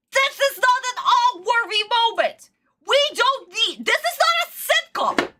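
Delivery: high-pitched, incredulous rant; exasperated anger. Real, not acted. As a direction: protest in outraged disbelief, voice pushed up high. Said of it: "I am so pissed".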